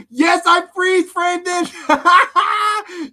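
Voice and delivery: high, drawn-out laughing yell; exuberant shouted confession. Real, not acted. Exuberant confession while cracking up.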